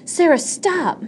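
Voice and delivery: high, alarmed command; urgent cry. A narrator's reading. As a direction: call out sharply to halt a child. Teacher cries out at girl.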